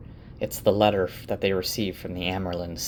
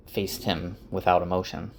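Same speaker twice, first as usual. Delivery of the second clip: ordinary talk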